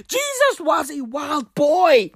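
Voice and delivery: slow, stretched bellow; drawn-out shout. Acted. Comic recovering from laughter, slowly bellowing the catchphrase. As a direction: drag the catchphrase out.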